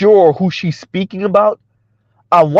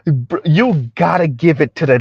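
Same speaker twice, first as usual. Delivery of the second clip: ordinary talk, nothing marked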